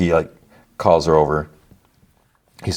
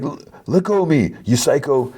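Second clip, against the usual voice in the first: retold cry; 8 semitones higher than usual. Pinned guy to the woman holding him down, mock cry. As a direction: imitate a pinned man protesting. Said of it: "cries out".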